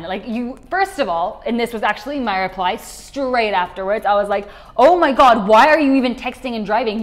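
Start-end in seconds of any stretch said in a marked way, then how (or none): 4.8-7.0 much louder, angry telling-off; mad scolding. Retold or imitated. Scolding a friend who crashed while texting, angry. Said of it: "I was mad A F".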